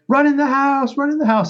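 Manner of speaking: high, urgent mock yell; high-voiced mock holler, not truly loud; retold yell. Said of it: "I'm yelling to my kids".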